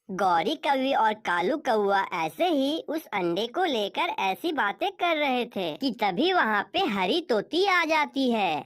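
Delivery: ordinary delivery, nothing marked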